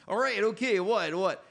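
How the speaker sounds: Howie Mandel impression. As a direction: fire it off like a jumpy stand-up host. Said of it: "my whole act used to be doing him".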